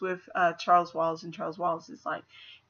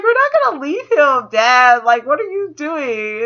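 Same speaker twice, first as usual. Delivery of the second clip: much higher, louder outrage; indignant; 6 semitones higher, 8 dB louder than usual. Retold or imitated. Meg refusing to leave her brother, indignant. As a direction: defy her father, appalled. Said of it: "She's like".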